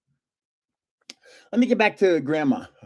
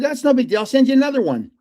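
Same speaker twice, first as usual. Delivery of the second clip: higher and louder, breezy brush-off; breezy dismissal; 8 semitones higher, 4 dB louder, faster than usual. Retold or imitated. To the dog warden, breezy retold brush-off. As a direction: wave it off easily.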